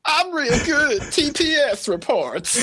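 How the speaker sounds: very high, slow cartoonish voice; Tutu. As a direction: play an eager, clueless job applicant in falsetto. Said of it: "talking like that".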